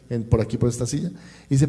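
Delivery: normal talking voice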